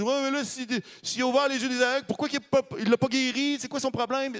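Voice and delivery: incredulous skeptic. Retold or imitated. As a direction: scoff like a doubting bystander.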